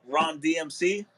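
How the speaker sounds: ordinary talk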